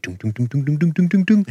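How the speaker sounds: rising run of even booms; thud-thud-thud, stepping up the street; imitated lights switching on. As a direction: climb in pitch with each "doom".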